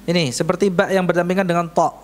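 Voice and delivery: ordinary talk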